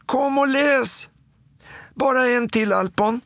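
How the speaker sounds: put-on high child voice calling. A narrator's reading. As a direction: call pleadingly in a small, high voice. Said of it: "Då ropar Småtting".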